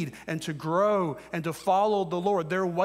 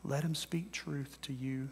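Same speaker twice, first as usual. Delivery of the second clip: softer and softer, near a whisper; hushed sermon close; 6 dB quieter than usual. Acted. Preacher easing listeners toward prayer, hushed. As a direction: let it sink to a hush.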